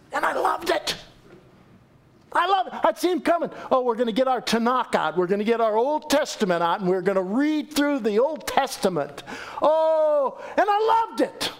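high and delighted; eager and pitched high; gushing joy. Real, not acted. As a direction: bubble over with how much you loved it. Gushing about Hebrew lessons.

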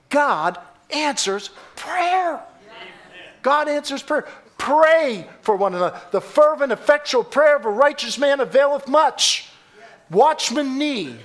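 high-pitched, emphatic proclamation; full-force preaching. Real, not acted. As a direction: press each word on the congregation.

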